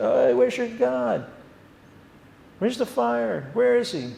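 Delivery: high, slow mocking taunt. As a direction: jeer like Elijah mocking the false god. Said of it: "taunting the prophets".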